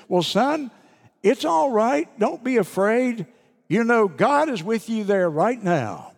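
high, loud reassuring call from bed; father calling back. Retold or imitated. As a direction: holler comfort across the house to the boy. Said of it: "he hollered to the little boy".

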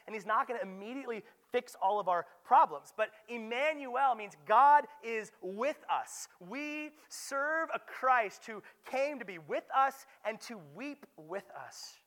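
loud, high preaching build; impassioned sermon voice; full-force preaching. Acted. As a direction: drive it home from the pulpit.

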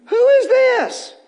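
amazed cry. Acted. Coworker amazed by the changed believer, high and drawn out. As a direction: marvel out loud.

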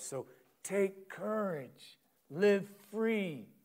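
slow, high, ringing encouragement; rising exhortation. Acted. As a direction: lift them up to courage. Preacher's climax voicing Jesus.

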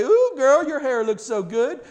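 high, loud, gushing friend; gushing compliment. Retold or imitated. Friend gushing over the pastor's hair, high and loud. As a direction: play a friend swooning over a haircut.

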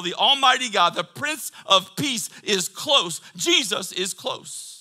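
loud, high, driving sermon; impassioned pulpit crescendo; full-force preaching. Real, not acted. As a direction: shout assurance to the whole congregation.

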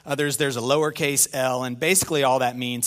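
usual speaking voice

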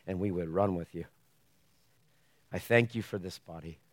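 hushed closing prayer before the sermon. Real, not acted. Opening prayer.

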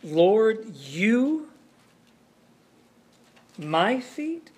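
preacher acting out Peter's shocked question to Jesus; shocked disbelief. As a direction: voice climbing in disbelief at the last words. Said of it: "that he's arguing".

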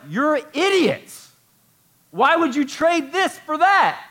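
high, loud, drawn-out exasperated yell; acted outburst of incredulous anger; angry Paul. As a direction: berate them at full voice, slowly and high. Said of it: "He's angry".

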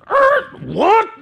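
hammed-up high shout; outraged disbelief. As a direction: explode at someone who just butted in. Exploding at second interrupter.

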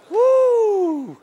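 high, loud falling "woo"; big preacher's whoop; celebratory whoop. Real, not acted. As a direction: let out a long high whoop. Whoops after congregation repeats.

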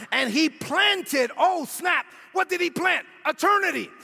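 high, emphatic exhortation to the congregation; impassioned sermon delivery; full-force preaching. Real, not acted. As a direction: drive each point home, rising to a cry.

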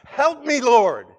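high, loud plea; crying out. Acted. Believer crying out at the throne of grace, pleading loudly. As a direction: call out for help from above. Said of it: "When you cry out".

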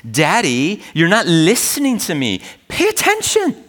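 put-on high, whiny toddler voice climbing to a squeak on pay; a small child demanding Daddy's attention; frustrated little child. Toddler to distracted dad, frustrated high-pitched demand. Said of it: "I remember a frustrated little voice".

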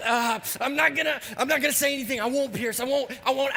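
high, bursting friend barely holding it in; eager friend. Retold or imitated. Matt fights the urge to spoil the movie, bursting. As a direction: play the friend who can't keep a secret.

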